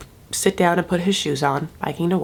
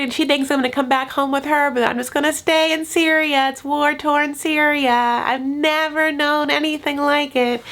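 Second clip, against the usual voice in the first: higher-pitched, naive storytelling voice; earnest young woman; 5 semitones higher than usual. Retold or imitated. Young woman explaining her wedding plan, imitated higher earnest voice.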